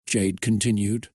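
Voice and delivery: ordinary delivery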